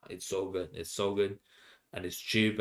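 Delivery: normal talking voice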